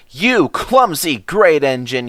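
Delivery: stormed. Acted. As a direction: signalman blowing up at the engine. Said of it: "he stormed".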